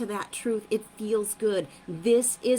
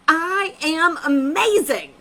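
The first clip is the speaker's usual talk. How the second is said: I am amazing, slow, loud and higher; excited affirmation; 6 semitones higher, 6 dB louder, slower than usual. Shown as a demonstration. Coach shows viewers how to affirm with excitement. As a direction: say it with all the excitement you can. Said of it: "with the most excitement and the bestest feeling".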